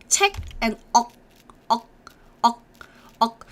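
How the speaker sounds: "책, 액" and "억" each end on a k closed off in the throat; unreleased final k. Shown as a demonstration. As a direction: clip every syllable with the tongue shutting the throat. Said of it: "closing your throat with your tongue".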